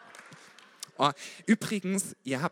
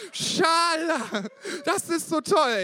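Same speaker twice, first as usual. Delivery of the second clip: far higher and louder, giddy fan cry; ecstatic shout; 13 semitones higher, 7 dB louder, slower than usual. Retold or imitated. Drunk-happy fan celebrating the win, shrieking with joy. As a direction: scream it while jumping around. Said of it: "hüpft mit mir da rum und schreit".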